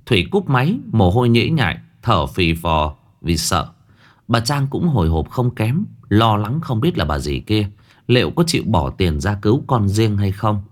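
ordinary delivery

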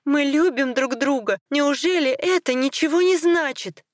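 high-pitched, desperate appeal; almost crying. A narrator's reading. Wife appeals to their love, almost crying. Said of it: "Она почти плакала".

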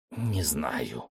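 soft, faltering reply; hesitant. A narrator's reading. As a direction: hesitate before answering. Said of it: "замялся зоолог".

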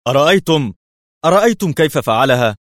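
high, loud stunned cry; can't believe what he just saw; astonished shout. A narrator's reading. Policeman amazed at the car's jump, loud high cry. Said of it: "في ذهول".

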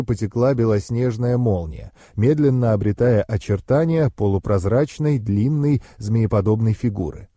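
plain reading voice

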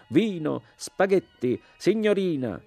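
very high, loud caricature of an Italian; mocking shout. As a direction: pull faces and squeal it out. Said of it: "facendo le boccacce e gridando".

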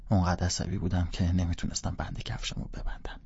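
usual speaking voice

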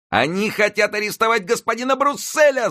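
put-on very high excited boy's yell; boy's shout. A narrator's reading. Friquet on the carriage roof shouting to the crowd, high boy's yell. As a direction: call the crowd to arms. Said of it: "закричал он".

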